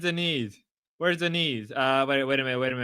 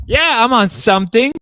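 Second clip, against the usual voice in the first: loud, high outburst; emphatic retort; 7 semitones higher, 12 dB louder than usual. Real, not acted. Streamer answering chat's question, emphatic retort. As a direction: fire it back at full voice.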